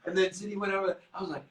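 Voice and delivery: ordinary delivery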